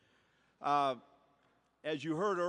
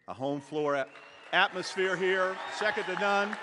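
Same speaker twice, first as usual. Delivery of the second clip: loud, high hype for the crowd; excited shout; 6 semitones higher, 5 dB louder than usual. Real, not acted. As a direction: pump up the fans. Hyping the home crowd.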